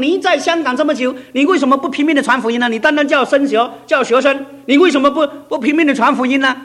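high, loud, pressing rebuke; God urging. Retold or imitated. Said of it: "那么神马上对我一感动". (高声急切地责问)

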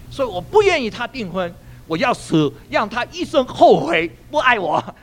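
high, loud mock anguish; hammed-up heartbroken protest; retold distraught girl. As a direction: imitate a distraught girl, pitch climbing. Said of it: "我就见她这样哭".

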